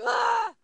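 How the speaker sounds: a short startled yelp of shock; mock shocked scream. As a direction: viewer shrieking at the twist, playful scream. Reacting to a shocking episode.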